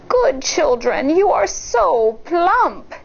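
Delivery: slow greedy fake granny; wolf as granny. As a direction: savour the words like a hungry wolf. Wolf relishing the children.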